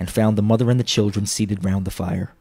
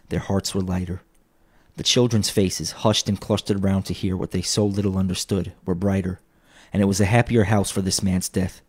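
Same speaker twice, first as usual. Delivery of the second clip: ordinary delivery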